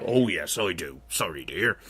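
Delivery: low, soft apologetic giant; sheepish giant. Acted. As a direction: mumble the apology as a meek giant. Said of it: "said the giant".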